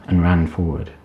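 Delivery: usual speaking voice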